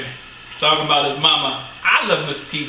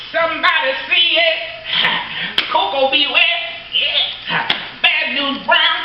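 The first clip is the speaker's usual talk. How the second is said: high-pitched revival call-and-response; mock preacher; 10 semitones higher, slower than usual. Fan preaching wrestlers' names to a congregation, mock revival call.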